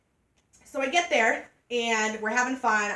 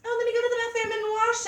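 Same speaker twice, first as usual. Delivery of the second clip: high, sing-out mock-polite excuse; mock mood-killer; 12 semitones higher, faster than usual. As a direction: pitch it way up, imitating a mood-killer. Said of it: "be like".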